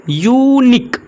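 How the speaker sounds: Unique pitched high on the "yu"; stressed yu. Shown as a demonstration. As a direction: lift and punch the "yu" of unique. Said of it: "यहाँ पे यू से उच्चारण हो रहा है".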